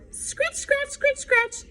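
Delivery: high, hushed scratch scratch; scratching sound. A narrator's reading. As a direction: tap it out like paws at the door. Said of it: "scratched at the door".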